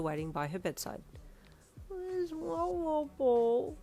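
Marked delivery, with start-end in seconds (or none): 1.9-3.8 put-on high, slow, weary little girl; tired toddler asking; sleepy toddler voice. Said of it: "she asked in a weary voice".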